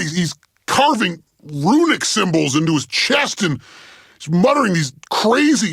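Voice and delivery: high-pitched, hammed-up horror; panicked commentary. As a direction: cry out the horror as you witness it. Horrified commentary on engineer's violent meltdown.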